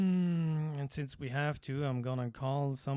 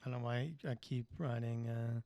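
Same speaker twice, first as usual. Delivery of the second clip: quiet murmur; 3 semitones lower, 8 dB quieter than usual. Acted. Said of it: "Why are we whispering?".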